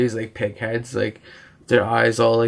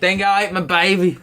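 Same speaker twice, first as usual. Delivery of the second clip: mock Australian accent; 7 semitones higher than usual. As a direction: play the Aussie cliché.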